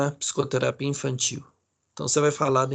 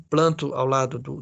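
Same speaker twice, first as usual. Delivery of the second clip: ordinary talk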